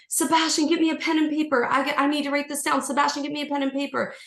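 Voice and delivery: high, rushed urgent call to Sebastian. Retold or imitated. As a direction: call out high and hurried for pen and paper. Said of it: "And I would say".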